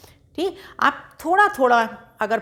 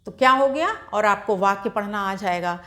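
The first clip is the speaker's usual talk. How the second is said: normal talking voice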